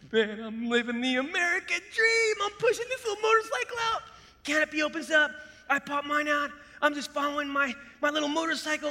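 high-pitched, giddy play-by-play of a stunt; hammed-up boyish thrill; exhilarated. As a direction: relive it like an action hero, peaking loud at the end. Reliving his thrilling motorcycle parachute jump.

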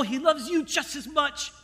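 usual speaking voice

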